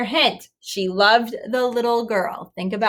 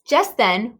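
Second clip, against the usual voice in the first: plain reading voice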